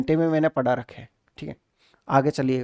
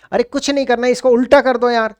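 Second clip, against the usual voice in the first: higher and louder, cheerfully dismissive; breezy exclamation; 5 semitones higher, 6 dB louder than usual. Acted. Teacher reassuring puzzled students, brushing the worry off loudly. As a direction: toss it off brightly, no problem at all.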